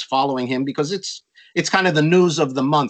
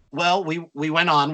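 usual speaking voice

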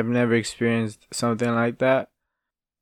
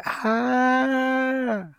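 mock scream; 13 semitones higher than usual. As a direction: imitate a wife's shocked scream. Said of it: "you just hear her yell".

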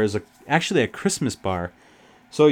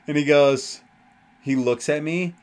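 normal talking voice

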